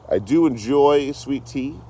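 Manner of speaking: high, slow, lilting take; high sing-song. Shown as a demonstration. Repeating a line about sweet tea, high and drawn out.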